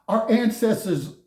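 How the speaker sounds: usual speaking voice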